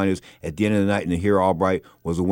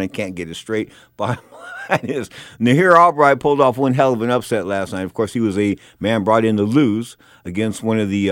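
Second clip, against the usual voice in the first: ordinary talk, nothing marked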